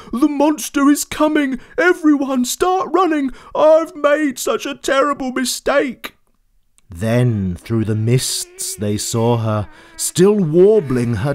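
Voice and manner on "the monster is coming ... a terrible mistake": high frantic cries; panicked warning. Acted. George warns the sleeping animals, high panic. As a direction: wake the herd in terror. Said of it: "he screamed them all awake".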